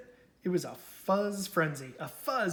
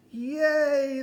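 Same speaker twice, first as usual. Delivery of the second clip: high, loud cheer; 10 semitones higher, 7 dB louder than usual. Acted. The crowd cheering Big Bark free of the fuzz, loud high cheer. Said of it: "the crowd cheered".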